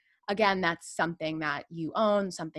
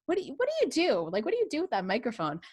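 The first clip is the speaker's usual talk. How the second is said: high-pitched imitation of puzzled friends; baffled friends; 6 semitones higher, faster than usual. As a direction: ask it way up high, in mock bewilderment. Acts out baffled college friends.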